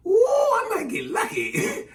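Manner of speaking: slow, very high mock glee; squealing like a silly, hopeful guy; ignorant guy fantasizing. Guy learning she has a twin, giddy mock glee. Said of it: "how ignorant guys could be".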